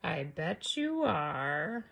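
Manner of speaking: low, slow, soft; sly drawl. Acted. Wolf humouring boastful Red, slow and sly. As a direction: humour her slyly. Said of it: "I said".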